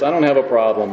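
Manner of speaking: ordinary talk, nothing marked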